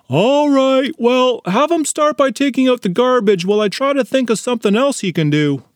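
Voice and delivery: high, probing mother's voice; scolding mom. Acted. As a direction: grill your son like a stern mom. Mother assigns chores.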